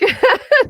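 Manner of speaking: a squeaky high little laugh; very high giggle. Real, not acted. Speaker giggling at her hint to Travis, high giggle.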